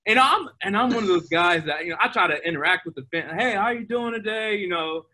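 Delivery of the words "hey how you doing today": friendly fan greeting. Retold or imitated. Greeting fans, retold.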